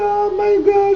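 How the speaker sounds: very high, drawn-out hammed-up wail; mock crying. Imitating Tiffany crying in the closet to Derek, mock crying. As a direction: imitate a squealing sob. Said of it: "freaking crying".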